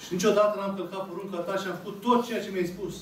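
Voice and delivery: normal talking voice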